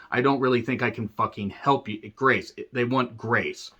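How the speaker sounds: usual speaking voice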